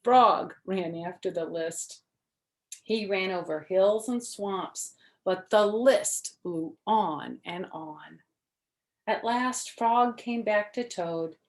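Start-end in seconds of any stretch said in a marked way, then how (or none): none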